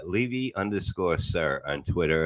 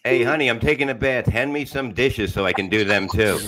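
mock bath request; 5 semitones higher, 5 dB louder than usual. Mock wife in bathtub kitchen.

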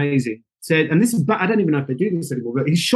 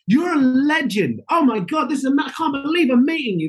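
starstruck gushing; 10 semitones higher than usual. Retold or imitated. Telling the host how he gushed at the casting director, high and breathless. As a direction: gush over a hero you just met. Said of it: "And I was like".

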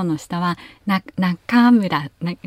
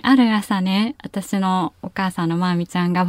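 ordinary delivery

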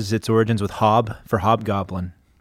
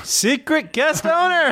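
hammy stage actor; slower than usual. Acted. High slow dramatic echo.

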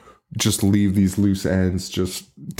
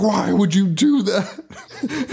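high, slow mock scream; hammed-up wail breaking into laughter; retold scream; 9 semitones higher, much slower than usual. Retelling Carl screaming at Alex over the invite, mock scream. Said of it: "Carl is screaming at the top of his lungs".

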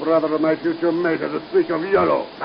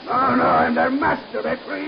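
frantic boast; 6 semitones higher than usual. Acted. Villain insists he's the spiders' master.

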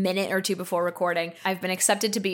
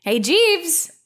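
mock summons, pitched up high; calling Jeeves; 7 semitones higher than usual. Podcaster summons an imaginary voice assistant, mock calling.

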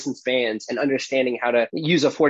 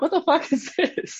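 much higher, slow, astonished squeal; stunned disbelief. Retold or imitated. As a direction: blurt it out, utterly amazed. Amazed by effortless uphill running.

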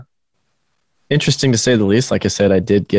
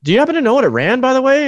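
casual innocent question; faster than usual. Retold or imitated. Self-quote asking about run time.

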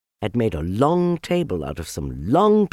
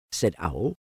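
ordinary delivery

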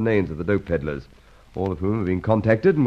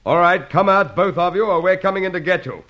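calling out; 7 semitones higher than usual. Acted. Police ordering men out of room.

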